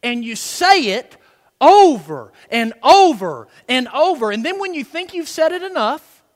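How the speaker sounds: loud, high, driving sermon climax; full-force preaching. Real, not acted. Preacher urging congregation to pound it into the heart, full-force preaching. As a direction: pound every repetition home, building to a shout.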